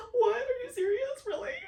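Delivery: loud, very high squeal of disbelief; hammed-up shocked wail; tearful astonishment. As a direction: squeal it as if crying with relief. Retold tearful shock at offered push.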